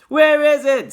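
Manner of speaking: loud, high shout. A narrator's reading. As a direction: call out over the crowd. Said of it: "somebody shouted".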